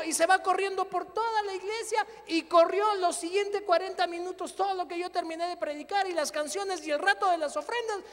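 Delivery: loud, high triumphant preaching; loud, high, fiery sermon climax; preaching at full force. Acted.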